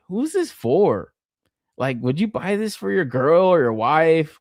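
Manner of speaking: ordinary talk, nothing marked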